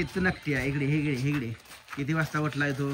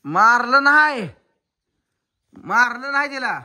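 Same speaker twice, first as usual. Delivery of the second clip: high, raised denial; loud protest; 7 semitones higher, 9 dB louder than usual. Real, not acted. Parent insisting the crying child wasn't hit, loud protest. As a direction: call it out high and loud, stretching the words.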